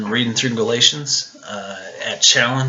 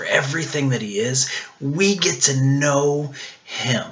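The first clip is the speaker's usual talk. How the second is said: ordinary talk, nothing marked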